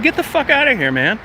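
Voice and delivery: high, exasperated get out; retold outburst. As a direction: throw him off the site in mock fury. Telling off the inspector.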